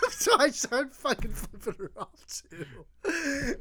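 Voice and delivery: words squeezed out through laughter; laughing while talking. Real, not acted. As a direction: crack up as you confess. Laughing at own story.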